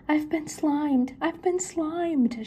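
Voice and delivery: panicked wail. Acted. Shaggy hit by slime, slow high wail. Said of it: "Shaggy cried".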